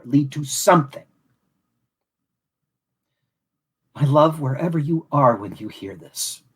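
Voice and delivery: measured, solemn reading of a prepared tribute; heartfelt closing address read from a script; formal speech. Acted. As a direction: deliver it like a dedication at a ceremony. Reads his formal closing speech.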